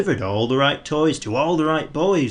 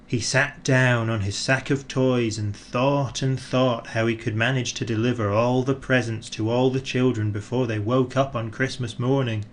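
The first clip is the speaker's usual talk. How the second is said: plain reading voice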